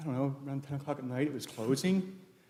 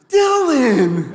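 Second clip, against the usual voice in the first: high, very loud shout; exclaimed at full volume; imitated triumphant yell; 13 semitones higher, 17 dB louder than usual. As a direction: belt out the name as if finally getting it. Acted climax of retold name debate.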